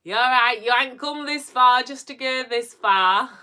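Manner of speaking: loud, high, brash Yorkshire pep talk; un-zen affirmation. Acted. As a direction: belt out the affirmation, nothing soothing about it. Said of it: "my voice does not sound at all zen".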